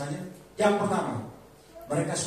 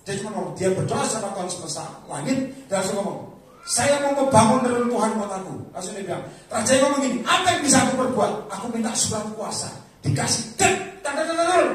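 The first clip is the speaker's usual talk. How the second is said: loud, raised Bible storytelling; impassioned, high-pitched sermon voice; fired-up preaching; 6 semitones higher, 10 dB louder than usual. Real, not acted. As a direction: retell the story forcefully to a congregation. Retells Nehemiah at full force.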